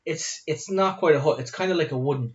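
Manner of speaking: usual speaking voice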